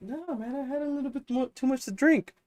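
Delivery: put-on high, soft drunk mumble; drunk friend. Drunk friend voiced in story.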